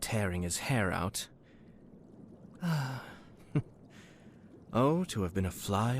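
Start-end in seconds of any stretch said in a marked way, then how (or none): 2.6-3.1 short falling sigh of delight; amused sigh. Acted. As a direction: sigh as if savouring the thought. Wishing to have seen it.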